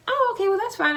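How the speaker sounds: high-pitched breezy act of being fine; lightly put-on casual agreement; playing it cool. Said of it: "I played it cool".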